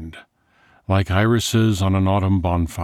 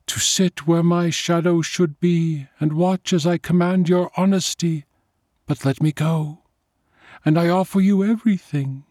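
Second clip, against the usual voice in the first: high, otherworldly tempter voice, coaxing; pleading vampire; 12 semitones higher than usual. A narrator's reading. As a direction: bargain for his life, sweet and desperate.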